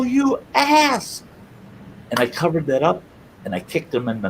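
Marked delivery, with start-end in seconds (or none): 0.0-1.2 high-pitched indignant outburst; retold outraged yell. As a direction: yell it like a wronged little brother. Retold outburst at stabbing brother.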